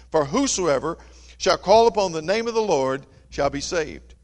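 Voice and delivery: loud, ringing scripture reading; preaching scripture. Acted. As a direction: proclaim the verses to the congregation. Reads scripture at full force.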